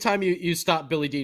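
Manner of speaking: ordinary talk, nothing marked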